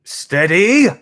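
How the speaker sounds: loud, high barked command; sharp shout. Acted. Cockney father shouting at jostling boys, loud sharp call. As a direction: yell it across the crowded dock. Father steadies boy on crowded dock.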